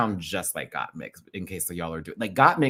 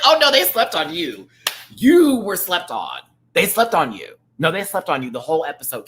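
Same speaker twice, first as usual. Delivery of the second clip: indignant outburst; 7 semitones higher, 8 dB louder than usual. Retold or imitated. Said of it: "I was like".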